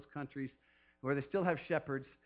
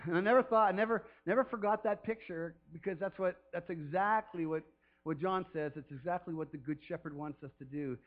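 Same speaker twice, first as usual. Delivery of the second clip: ordinary delivery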